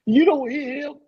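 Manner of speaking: high, loud, incredulous mock scolding; acted scolding. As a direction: stretch it out in exaggerated disbelief. Acts out mother scolding the father.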